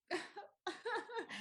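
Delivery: high, breathy burst of pretend sobs; half-laughing imitation of crying; mock sobbing. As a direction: sob for comic effect. Acting out crying on massage table.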